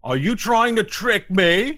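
slow, high, loud ogre voice; big slow-witted ogre, suspicious. Acted. Ogre challenging a stranger at the window, suspicious.

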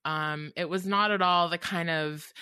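ordinary talk, nothing marked

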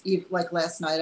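normal talking voice

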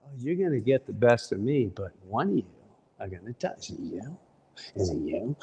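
low, softening calm threat; father's low taunt fading almost to nothing; calm dad. Retold or imitated. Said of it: "my father's going".

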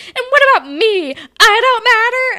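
high, loud, indignant whine; mock Claire wailing that she's left out; shrill-free high pitched protest; whiny Claire. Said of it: "And Claire's like".

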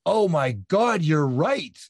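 retold epiphany, high and loud; excited realization. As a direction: exclaim it like a sudden revelation. Retelling his sudden realization.

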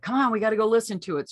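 fast, loud and high, bursting with eagerness; imitating an excited father; eager dad. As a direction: rush him out the door. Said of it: "So he was like".

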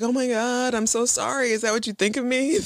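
mock heartfelt sorry; acted apology. Acting Buffy apologising to Dawn.

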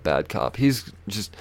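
ordinary delivery

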